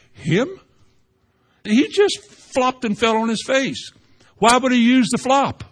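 high-pitched disbelieving protest; incredulous disciples. Acted. Other disciples object to Jesus choosing Peter, incredulous high protest. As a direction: jump up in pitch, scandalized by the choice. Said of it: "look at each other and say".

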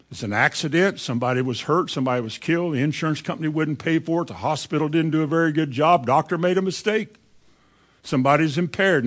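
ordinary talk, nothing marked

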